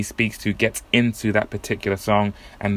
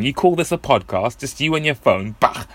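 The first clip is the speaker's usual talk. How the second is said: mock-scornful higher voice; scoffing imagined Rob; 4 semitones higher than usual. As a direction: sneer at the humble phone setup. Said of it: "I bet Rob's thinking".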